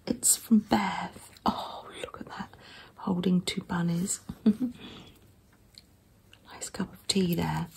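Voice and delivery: soft close whisper; ASMR whisper. Real, not acted. Showing a handmade zine to viewers in a gentle whisper. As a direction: hush every word for the listener. ASMR journal flip-through.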